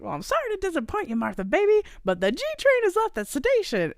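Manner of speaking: high, put-on showy refusal; imitating the Grinch. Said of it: "he's like".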